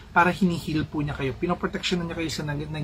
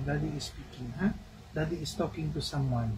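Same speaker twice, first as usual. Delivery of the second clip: softer hushed explanation; 7 dB quieter than usual. Real, not acted. Mother softly explaining to her toddler, hushed.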